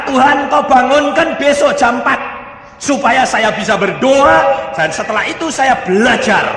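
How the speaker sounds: high, loud insistent praying; fervent prayer. Acted. Child asks God to wake them at four, loud fervent prayer. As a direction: pray it out at full voice.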